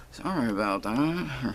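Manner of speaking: slow, lightly done West Country Hagrid accent; a deliberately bad Hagrid accent, drawn out. Shown as a demonstration. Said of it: "Sorry, horrible accent".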